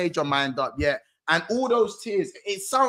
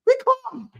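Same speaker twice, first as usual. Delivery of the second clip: very high, squeaky reassurance; schoolkid covering up. Retold or imitated. Schoolkid refusing to snitch to the head teacher, in a very high voice. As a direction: shoot right up high, insisting it's fine. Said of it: "You go".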